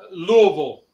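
Louisville run together as "LOO-uh-vul"; local pronunciation. Shown as a demonstration. Friend falls for the capital question, Louisville said locally as "LOO-uh-vul". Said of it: "How do you pronounce".

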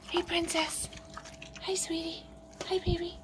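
quiet doting hello to the birds; soft pet greeting. Real, not acted. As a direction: greet the sleeping birds gently.

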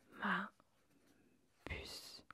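ordinary delivery

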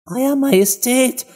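high, slow, grovelling subject; submissive. A narrator's reading. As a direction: address the queen meekly, pitched well up. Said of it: "sagte Zwei unterwürfig". (unterwürfig)

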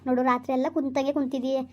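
normal talking voice

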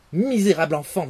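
furious king. Acted. The father casts out his son, loud and furious. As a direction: spit out the insult. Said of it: "le roi, irrité et implacable".

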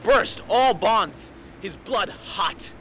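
high, forceful declaiming that builds to a drawn-out shout; impassioned war-cry tirade. Acted. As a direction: build from fierce intensity to a full shout. Impassioned monologue building to climax.

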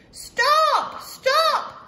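high-pitched loud yell. Acted. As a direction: shout after the runaway cookie. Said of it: "they yelled".

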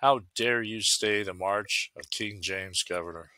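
plain reading voice

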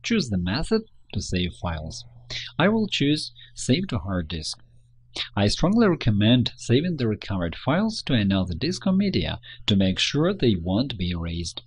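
normal talking voice

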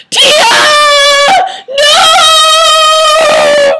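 very high, loud drawn-out wail; hammed-up despairing scream. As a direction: scream after her, dragging out the vowels. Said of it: "he screamed after me".

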